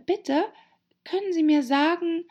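hohe, zaghaft fragende Mädchenfigur; höflich und vorsichtig mit hoher Stimme fragen; etwas schüchtern. A narrator's reading. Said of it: "begann Alice ein wenig schüchtern". (ein wenig schüchtern, mit hoher Stimme)

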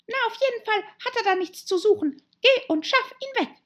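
high, rapid, loud animal voice; bossy Rabbit. Acted. Rabbit sending Pat to remove the arm, high and impatient. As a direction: rap out orders in a high, cross voice.

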